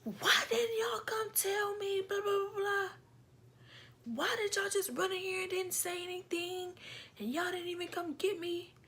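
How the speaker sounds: high voiced mom scolding the kids; a mother's exasperated why. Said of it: "She's like".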